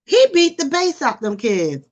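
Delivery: ordinary talk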